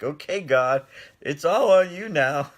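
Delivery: loud, high cry of surrender; surrendering to God. Retold or imitated. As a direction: throw it all on God, laughing. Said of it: "I'm like".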